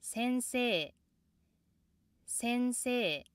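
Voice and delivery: 先生 high from the first sound, dropping at the end; 先生 held high until the final fall; start high. Shown as a demonstration. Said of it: "which start with the high pitch".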